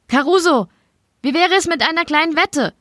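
a high, desperate call over the struggle; yelling. A narrator's reading. Shorty being dragged out, calls back to the Don in a high mock yell. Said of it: "brüllt er noch".

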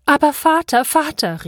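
high, pleading cry; mother crying out. Acted. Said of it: "rief sie". (flehend und hoch gerufen)

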